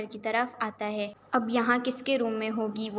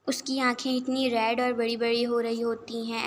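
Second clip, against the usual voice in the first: ordinary delivery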